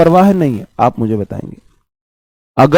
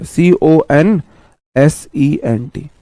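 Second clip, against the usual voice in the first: very slow letter-by-letter सीओएनएसईएनटी; spelling out letters; much slower than usual. Shown as a demonstration. Teacher spelling consent for the class, very slow. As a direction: spell C-O-N-S-E-N-T one letter at a time. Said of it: "consent का मतलब".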